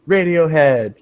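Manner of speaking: mildly excited exclamation; a touch of excitement on the name. Shown as a demonstration. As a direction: say it like a keen exclamation. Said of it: "said it in a very excited voice".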